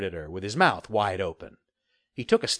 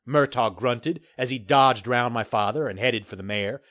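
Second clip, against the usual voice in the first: plain reading voice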